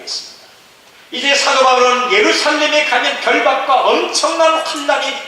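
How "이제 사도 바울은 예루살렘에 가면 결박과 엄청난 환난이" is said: high, loud, sustained proclamation; full-force preaching. Acted. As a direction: preach at the top of the register without letting up. (높고 크게 부르짖는 설교)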